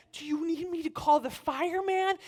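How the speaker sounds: high, careful child imitation; articulate little girl. Oldest girl escalating her offers, articulate child voice. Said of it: "she's very articulate".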